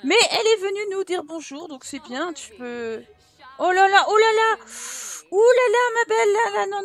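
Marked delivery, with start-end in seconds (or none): 3.6-6.8 high, loud mock alarm at the Sim; hammed-up fussing over a hysterical Sim. Player fussing at her panicking Sim, mock alarm.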